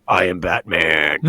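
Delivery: put-on a small kid trying to sound deadly serious as Batman; dad imitating his son's serious Batman voice; gravelly Batman voice. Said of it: "trying to be, like, all serious".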